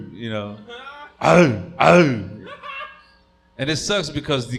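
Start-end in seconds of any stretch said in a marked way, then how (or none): 1.2-2.3 loud, barking yelps like a robot dog; loud Terminator dog bark. Retold or imitated. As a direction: bark it out like a menacing machine. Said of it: "barks like the Terminator".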